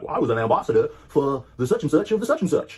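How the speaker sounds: loud, high blustering imitation; mock outraged Brit. Retelling the man's boast about his service, mock outraged. Same retold man, blustering reply.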